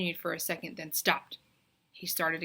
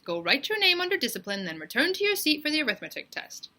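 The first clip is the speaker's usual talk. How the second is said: bossy teacher; 8 semitones higher, 6 dB louder than usual. Acted. Teacher orders Myron.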